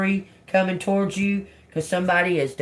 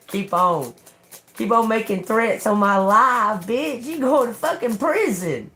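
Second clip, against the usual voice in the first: defiant taunt; 4 semitones higher than usual. Real, not acted. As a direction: throw it back at them, pitch climbing. Defying threats against her life.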